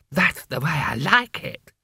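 lisping. A narrator's reading. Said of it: "it lisped".

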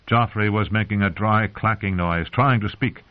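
plain reading voice

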